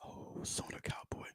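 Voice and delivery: soft unvoiced whisper; whispering on the phone. Real, not acted. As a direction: whisper it, barely audible. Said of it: "Why am I, why am I whispering?".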